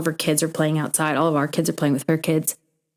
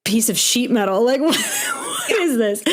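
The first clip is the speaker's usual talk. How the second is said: much higher, slow, laughing at the thought; laughing disbelief; 5 semitones higher, slower than usual. Retold or imitated. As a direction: laugh out the question. Joking about junkyard van.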